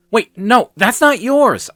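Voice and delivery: high, loud flustered objection; urgent, flustered interruption; stammered protest. A narrator's reading. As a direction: blurt it out in alarm. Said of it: "I stammered".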